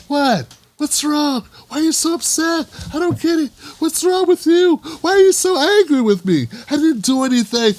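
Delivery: hammed-up baffled innocence. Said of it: "That's when they go".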